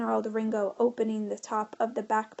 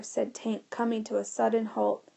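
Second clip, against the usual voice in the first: ordinary delivery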